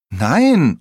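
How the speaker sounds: loud, high protesting shout; calling out. A narrator's reading. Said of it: "ruft Michi".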